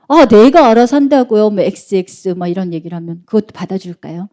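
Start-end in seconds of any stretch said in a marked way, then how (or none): 0.1-1.5 put-on mouthy teen, louder and irritated; 대드는 사춘기 아이. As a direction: snap loudly at mom as a rude teenager. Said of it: "애가 엄마한테 욕을 해요". (엄마에게 대드는 짜증 난 말투)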